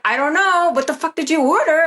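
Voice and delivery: high-pitched retold bafflement; imitating bewildered husband.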